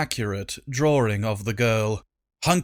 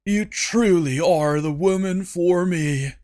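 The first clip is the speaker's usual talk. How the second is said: swooning; 4 semitones higher than usual. Acted. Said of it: "stood in his swoon".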